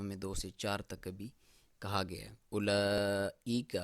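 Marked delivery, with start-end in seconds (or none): 2.5-3.8 اولئک chanted, the madd held long; slow recitation with madd. Shown as a demonstration. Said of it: "clear ہونا چاہیے حمزہ کی sound بھی".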